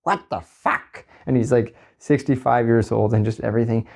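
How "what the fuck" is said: high, drawn-out cartoon outrage; safety guy voice. Worker mimicking the site's safety guy, cartoon exasperation. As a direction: imitate an exasperated cartoonish older boss. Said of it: "I do that voice at work".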